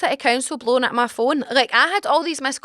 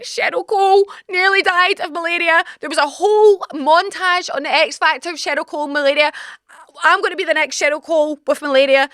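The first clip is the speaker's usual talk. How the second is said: mock hysteria; 5 semitones higher than usual. Dramatic rant about [name].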